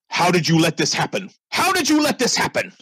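high, strained accusing shout; furious father. Acted. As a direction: shake the butler and demand answers. Furious father confronts butler.

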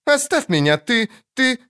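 high-pitched, spluttering protest; indignant child. A narrator's reading. Caught girl tells Geralt to leave her, indignant. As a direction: stumble on the insult in a girlish squeal.

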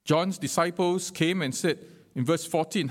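ordinary talk

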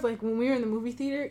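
ordinary talk

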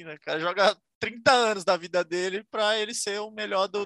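ordinary talk

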